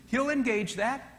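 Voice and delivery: ordinary delivery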